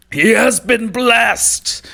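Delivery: high, loud, slow roaring proclamation; Gungra roar. Acted. Gungra roaring to goblins after the ritual, triumphant. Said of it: "you hear Gungra roar".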